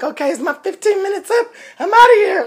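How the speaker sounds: high, rising exasperated quit; fed up exit. Retold or imitated. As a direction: throw up your hands and leave. Quitting the stage.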